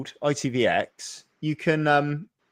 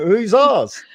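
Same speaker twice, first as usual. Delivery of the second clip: high, loud retold question; mock eager question; 10 semitones higher, 7 dB louder than usual. Son asking mum about their secret relative, mock eager. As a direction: pitch it up, playfully demanding to know.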